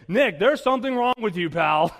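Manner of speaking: loud, high mock outrage; indignant scolding. Congregation telling off a bored groom, loud mock indignation. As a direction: call him out loudly, hammed-up.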